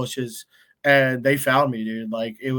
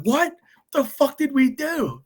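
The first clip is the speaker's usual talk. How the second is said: baffled; 12 semitones higher than usual. Retold or imitated. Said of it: "We're like".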